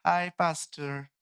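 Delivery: slow, drawn-out casual hi; mock a lazy greeting. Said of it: "नया word आया है, हाय". (लापरवाही से हाय पास्टर)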